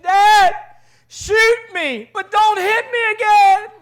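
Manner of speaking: high-pitched, loud desperate pleading; hammed-up wail of a spanked boy; brother's yell. Brother begging Dad during a spanking, yelling. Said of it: "I'm hearing my brother just yell".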